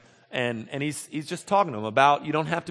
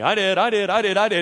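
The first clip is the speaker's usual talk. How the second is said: eager crowd voices; 6 semitones higher, 6 dB louder than usual. Retold or imitated. Crowd eagerly claims touching Jesus.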